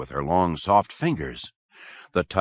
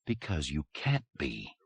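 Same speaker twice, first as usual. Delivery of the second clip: softer, calm and certain; cool control; 3 semitones lower, 10 dB quieter than usual. A narrator's reading. Doctor calmly telling the patient who he is not, softly. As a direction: state it with quiet confidence. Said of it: "Musina's voice projected cool control".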